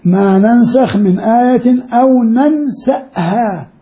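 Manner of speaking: slow recitation, hamza in ننسأها clearly stopped; recited with hamza. Shown as a demonstration. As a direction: read the verse slowly, marking the hamza. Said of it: "قرأ هكذا".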